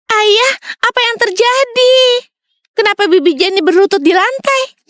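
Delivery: put-on high-pitched child's voice; high, innocent questioning; puzzled young daughter. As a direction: ask father in high, wondering tones.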